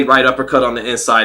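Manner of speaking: ordinary delivery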